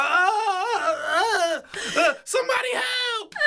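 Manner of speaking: high wailing mock sobs; mock crying for help. Mocking her wailing on the ground for help, high wail.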